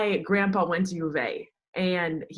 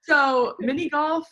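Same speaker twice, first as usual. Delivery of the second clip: drawn out, higher and louder, playfully offended; mock indignant; 9 semitones higher, 7 dB louder, slower than usual. Real, not acted. Answering the cohost's mini golf joke, slow playful outrage. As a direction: stretch it out like the question is absurd.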